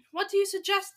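high, loud, urgent question; crying out. A narrator's reading. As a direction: appeal as an agitated crowd. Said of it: "they cried out".

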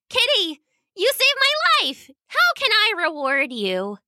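very high little-princess voice; grateful little girl. A narrator's reading. Little princess thanking the cat who saved her, grateful.

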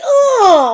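very high, loud imitated cry; hammed-up sudden yelp; mock outcry. Acting out the outcry mid make-out.

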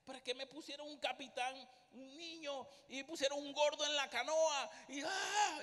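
venting about the team you were given; indignant rant building. Retold or imitated. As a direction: build from a complaint into an exasperated yell.

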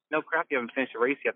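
ordinary talk, nothing marked

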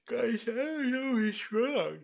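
talking through a yawn. Real, not acted. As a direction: yawn while speaking. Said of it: "I don't know why I'm yawning".

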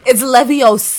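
mock British Harry Potter voice. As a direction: correct them in an over-the-top British accent. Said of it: "do Harry Potter".

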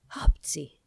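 loud mock sneeze on the word; acted sneeze. A narrator's reading. King answering his sages with a sneeze. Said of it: "válaszolt rá a király".

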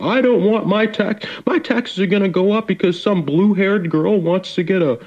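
high, whiny mock Southern grumbler; a hint of a griping Southern dad; Southern complainer voice. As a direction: whine it like a small-town grouch. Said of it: "You sound like the Southern person".

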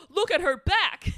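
high, loud alarmed cry; mock shout. Friends shouting at the arching demon girl, loud mock alarm. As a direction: shout it as if horrified.

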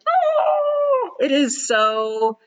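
a soaring, drawn-out high "Oh"; delighted oh. Real, not acted. Touched speaker hearing a client's success, soaring delighted oh. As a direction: let the "Oh" leap way up in delight. Moved reaction to client's letter.

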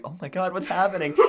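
high, laughing mock alarm; high-pitched, half-laughing group freak-out; mock panic. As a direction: retell a class freaking out. Class reacts to melting pineapple.

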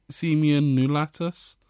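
"semian nulatus" going up at the end; tentative Latin name, "nulatus" lifted like a question; questioning rise. Real, not acted. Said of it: "It's the way it goes up at the end".

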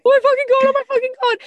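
high loud panicked squealing. Retold or imitated. Retold panic after quitting.

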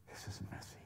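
soft unvoiced whisper of scripture; whispered prayer. Real, not acted. Preacher silently praying before the shaking neighbor, whispered. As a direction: breathe the verse to steady yourself. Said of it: "praying like crazy".

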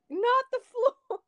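high, slow, laughing mom voice; a laughing take on a fretting mom; imitating mom. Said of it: "my mom was like".

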